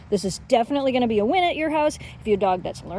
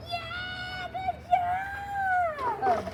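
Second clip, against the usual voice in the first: excited cheer; 5 dB quieter, much slower than usual. Real, not acted. Dog fetched ball.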